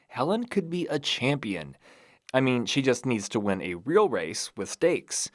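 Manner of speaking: ordinary delivery, nothing marked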